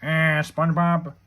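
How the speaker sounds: drawn-out cartoon grump voice; slow, put-on cartoon voice; Squidward. Shown as a demonstration. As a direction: slowly groan it out as a cartoon character impression. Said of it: "Do a Squidward voice".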